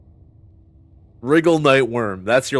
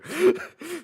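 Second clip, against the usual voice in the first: squeaky high laugh; high-pitched cackle; 3 dB quieter than usual. Real, not acted. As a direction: let out a high giggle. Laugh burst.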